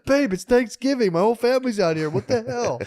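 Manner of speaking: put-on high complaining girlfriend voice; mock girlfriend outrage. Girlfriend complaining about Thanksgiving with family, high whine.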